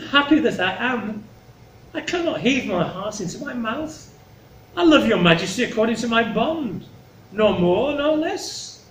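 high, measured, formal verse delivery; earnest Cordelia. Acted. Cordelia refuses the king flattery, earnest and measured. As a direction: declare it simply, in a high stately voice.

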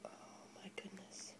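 breathless whispered oh my gosh; hushed, barely voiced wonder; awed whisper. Real, not acted. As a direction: whisper it in pure awe. Awed reaction to opening.

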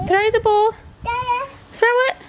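high, loud encouraging; high coaxing. Real, not acted. Coaxing toddler to play.

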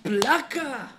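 imitando al rapero. Retold or imitated. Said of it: "El vato".

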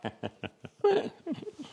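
very high mad scientist cackle. Acted. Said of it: "le savant fou que je viens de faire".

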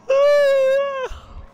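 long, high-pitched tired sigh; real high sigh of tiredness. Real, not acted. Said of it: "I'm tired".

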